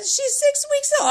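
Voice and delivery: high, drawn-out retold answer; high protest. Mother answers uncle about baby's age, high protest. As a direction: protest it slowly, pitched well up.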